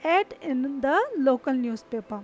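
ad in the local newspaper, said very slowly; slow it right down; slow example. Shown as a demonstration. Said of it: "I saw". (धीमा उदाहरण)

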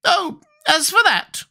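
very high squeaky creature; high-pitched squeaky character; Gump's squeaky voice. A narrator's reading. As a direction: squeak it out, far above your own voice. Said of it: "returned the Gump".

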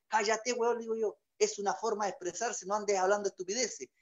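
quiet embarrassed telling-off; hushed scolding. Acted. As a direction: hush him before people laugh. Hushing friend in crowd.

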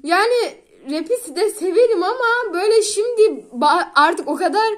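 usual speaking voice

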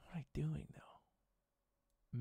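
unvoiced puzzled whisper; whispering to himself. Real, not acted. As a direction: breathe the question out to yourself. Muttering to himself while stuck.

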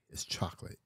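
"chocolate" whispered the English way, two syllables, CHOK-lit; whispered English pronunciation. Shown as a demonstration. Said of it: "I know the way you say chocolate".

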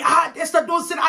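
mock scandalised telling-off; shocked auntie. Auntie hushing the girl's swearing, high shocked reproach.

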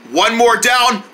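ordinary talk, nothing marked

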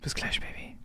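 whispered creepy sweet talk; whispered goodbye. Acted. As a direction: breathe it softly. (geflüstert)

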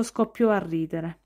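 plain reading voice

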